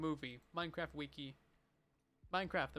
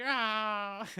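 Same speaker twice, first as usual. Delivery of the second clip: mock golem death cry; 3 semitones higher, 4 dB louder than usual. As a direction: imitate the little robot's sad dying yelp. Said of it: "really sad noises when it dies".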